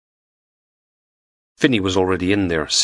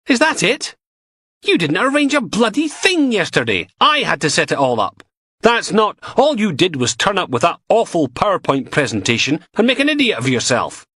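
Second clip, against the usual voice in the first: angry retort; 12 semitones higher than usual. Acted. Logan shouting back at the DI, scornful. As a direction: hit back hard.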